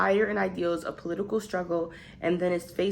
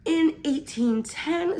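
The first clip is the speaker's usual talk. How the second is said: slow, high mock British accent; playful British accent; 9 semitones higher, slower than usual. Reading a Victorian-era summary in a mock British accent. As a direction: put on a posh British accent for a period piece. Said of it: "I feel like I have to do an accent with it".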